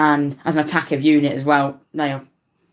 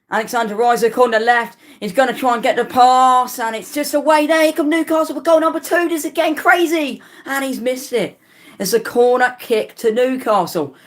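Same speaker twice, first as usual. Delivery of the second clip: much higher and louder live commentary call; excited play-by-play; 9 semitones higher, 3 dB louder than usual. Real, not acted. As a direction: ride the attack as it builds, voice climbing.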